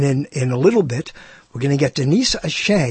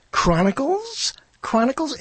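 mock puzzled question; 9 semitones higher, 3 dB quieter than usual. Mock puzzled at his own word.

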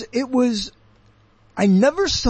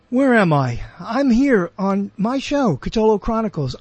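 normal talking voice

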